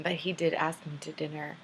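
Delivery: usual speaking voice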